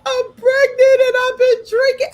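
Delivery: very high, loud mock-crying wail; panicked wail. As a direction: re-enact panic in a tearful squeal. Retelling her panic at pregnancy.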